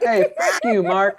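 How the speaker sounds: high-pitched imitation of a laughing friend; imitating laughing friend. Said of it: "mouth full and laughing".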